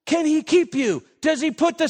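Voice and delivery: high, hammed-up fretful oh's with a laugh; mock hand-wringing panic. Preacher mocking panicking Christians, hammed-up fretful oh's. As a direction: play the fretting believer for laughs. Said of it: "wringing of hands and saying".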